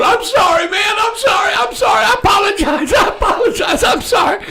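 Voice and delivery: very high, frantic mock apology; hammed-up falsetto pleading. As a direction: imitate a wounded man begging, squealing high. Shot man begging, acted out.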